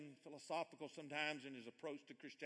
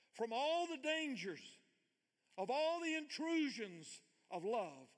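higher, slow and deliberate, each word weighed; slow emphatic reading; 9 semitones higher, 4 dB louder, slower than usual. Shown as a demonstration. Preacher reading C S Lewis to the congregation, slow and intense. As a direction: lean into the dangers, slowing right down. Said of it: "so I'm gonna read it slow".

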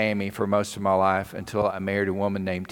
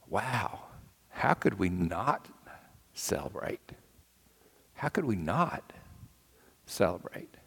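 quiet wonder; 2 semitones lower, 8 dB quieter than usual. Retold or imitated. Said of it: "I can imagine my teacher thinking".

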